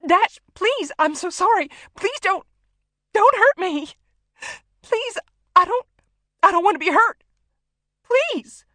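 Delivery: very high, loud, tearful pleading; hysterical, crying plea; terrified begging. A narrator's reading. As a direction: beg for your life in panic. Hostage begs gunman not to hurt her.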